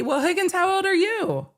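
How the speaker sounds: put-on squeaky voice teasing him as a baby; high mock baby talk. Teasing him for being younger, in a high cooing baby-talk voice. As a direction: coo it like to a baby.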